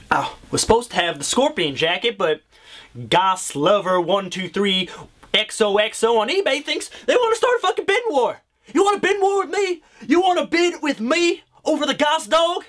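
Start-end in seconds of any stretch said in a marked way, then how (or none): 5.3-12.6 rising pitch, sputtering fury at a rival bidder; fast, high-pitched outrage; shrieking at the eBay bidder; angry rant. Acted.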